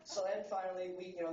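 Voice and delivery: normal talking voice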